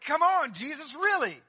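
incredulous. Retold or imitated. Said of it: "They're like".

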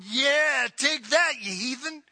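jeering taunt at the heathen; gloating jeer. Retold or imitated. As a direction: crow over the enemy. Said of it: "I can imagine Israel going".

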